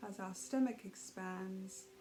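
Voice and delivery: calm, even meditation voice with long pauses; guided meditation. Real, not acted. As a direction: ease them gently through each breath, unhurried. Leads a guided breathing meditation.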